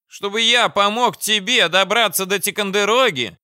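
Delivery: high, loud indignant fat man; outraged question from a portly commander. Acted. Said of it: "возмутился толстяк".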